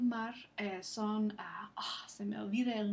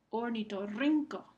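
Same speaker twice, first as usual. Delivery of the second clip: "Ornitorrinco" with RRIN stronger; stress on RRIN. Shown as a demonstration. Teacher repeats for listening students, stress on RRIN in "Ornitorrinco". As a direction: lean on the next-to-last syllable of "Ornitorrinco". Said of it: "Rin es la sílaba que recibe".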